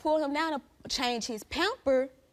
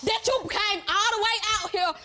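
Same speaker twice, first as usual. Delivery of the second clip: much higher, pressing angry rant; furious accusation; 8 semitones higher than usual. Real, not acted. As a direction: defend yourself, firing back at the accuser. Heated onstage confrontation.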